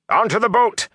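high-pitched yelled command; high, strained emergency yell; urgent shout. A narrator's reading. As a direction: shout them aboard in alarm. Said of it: "Tom shouted".

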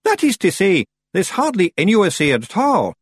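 high, loud little Scots Feegle. A narrator's reading. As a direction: pipe up like a guilty tiny pixie. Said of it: "the voice said".

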